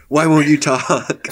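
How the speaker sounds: loud, demanding interrogator; interrogation shout. Acted. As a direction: yell it at the prisoner.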